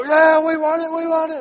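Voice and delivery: high, loud team shout; fired-up players. Retold or imitated. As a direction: yell it back like pumped-up players. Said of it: "he gets them all fired up".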